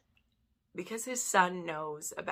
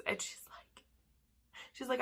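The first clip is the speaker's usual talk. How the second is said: usual speaking voice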